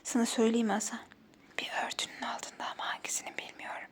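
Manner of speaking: unvoiced whispered hint; whispering. Real, not acted. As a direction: whisper the clue in his ear. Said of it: "Sessiz olarak söylesem olur mu".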